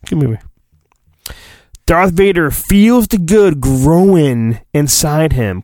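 ordinary talk

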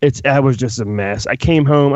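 ordinary talk, nothing marked

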